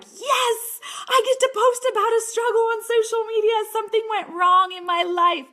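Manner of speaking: high, laughing and delighted; laughing high and loud with glee; gleeful laugh. Retold or imitated. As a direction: squeal it out like good news. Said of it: "laughing and having fun with it".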